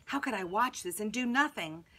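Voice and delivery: plain reading voice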